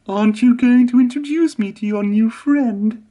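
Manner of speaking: high, sniffy lorry voice; teasing Elizabeth. A narrator's reading. Elizabeth teases Emily about Whiff, high and sniffy. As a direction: needle her about the new friend, high. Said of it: "sniffed Elizabeth".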